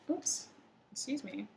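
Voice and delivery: normal talking voice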